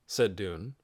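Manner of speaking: usual speaking voice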